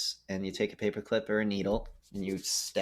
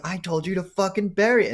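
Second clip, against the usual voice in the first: much higher, louder mock rage; angry; 10 semitones higher, 6 dB louder than usual. Hopkins's character blames Baldwin over the rag, loud and furious. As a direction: yell the accusation at him. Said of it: "he's like".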